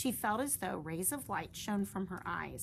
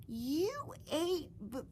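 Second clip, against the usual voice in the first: high slow stutter, louder on bugs; horrified stutter; high shocked stammer; 10 semitones higher, 4 dB louder, much slower than usual. Acted. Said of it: "stuttered one".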